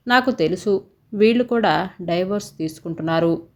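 ordinary delivery, nothing marked

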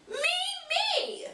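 thrilled squeal. Acted. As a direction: burst with delight at the gift. Hamster thrilled at surprise.

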